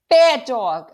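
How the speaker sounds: put-on boy yelling at the dog. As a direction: shout it down at the dog. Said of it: "I'm yelling".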